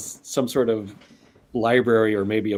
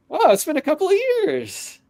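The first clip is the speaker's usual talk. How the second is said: very high, amazed greeting; pleased surprise; 3 dB louder than usual. Acted. Centaur greets long-absent Clancy, pleasantly surprised. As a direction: marvel at an old friend's return.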